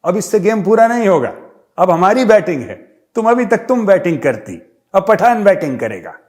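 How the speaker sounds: put-on loud Pathan accent claiming his turn to bat. Pathan demanding his batting turn, in a loud mock Pathan accent. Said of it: "तो पठान ने बोला".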